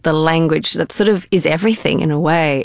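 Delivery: ordinary delivery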